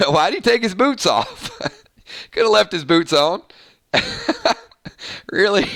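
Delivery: talking through real laughter; laughing. Real, not acted. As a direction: crack up while trying to get the words out. Cracks up at own boots joke.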